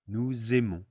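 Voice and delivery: "Nous aimons" joined as noo-zay-mon; liaison Z. Shown as a demonstration. Teacher summing up the liaison rule, "Nous aimons" with Z. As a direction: sound the S of "Nous" as a Z before "aimons". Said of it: "gonna be a Z sound".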